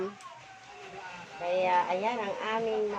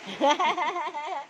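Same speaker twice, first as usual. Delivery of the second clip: high rippling laugh; 4 semitones higher than usual. Real, not acted. Vlogger recounting the rain on Madam's stall, laughing. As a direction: burst into a quick, high laugh.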